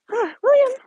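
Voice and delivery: high call to a pulling dog. Real, not acted. Calling to the dog pulling ahead.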